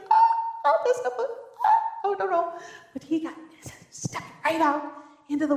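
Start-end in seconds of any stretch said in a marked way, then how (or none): 0.1-2.5 high yelping oh oh, no no; squeaky comic terror; mock panic. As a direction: panic at the sight of the waves, hammed up. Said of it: "I'd be like".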